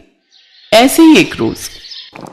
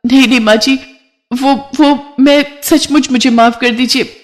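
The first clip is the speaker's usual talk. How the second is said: high, stammering frightened bride; panicked pleading; 7 semitones higher than usual. Acted. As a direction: beg not to be punished, voice pitched up. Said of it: "तू इतना घबरा क्यों रही है". (घबराकर गिड़गिड़ाती ऊँची आवाज़)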